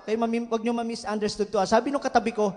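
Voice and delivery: normal talking voice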